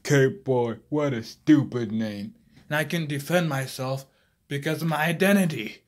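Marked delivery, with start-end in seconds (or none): 0.0-2.4 mocking classmates. Classmates mocking Cape Boy, loud fake laughter. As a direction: jeer like schoolyard bullies. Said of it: "everyone at school was laughing".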